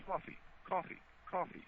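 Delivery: COFFEE said cawfee, softly, no w; soft cawfee, short aw; plain coffee demonstrated. Shown as a demonstration. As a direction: model coffee quietly as cawfee. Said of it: "you're stressing the I. It's just coffee".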